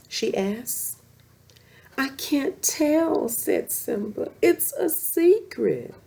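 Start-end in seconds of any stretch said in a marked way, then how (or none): 2.0-3.3 high, slow little cub; a small lion cub holding back a secret; young cub voice. Acted. Simba refusing his mother, in a high, slow cub voice. 4.4-5.9 a small lion cub holding back a secret; young cub voice. Acted. Cub Simba answers his mother.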